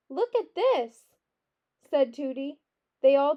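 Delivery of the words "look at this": high, drawn-out call for attention; excited. A narrator's reading. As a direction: show off a discovery, stretched and high. Tooty excitedly shows the lamp.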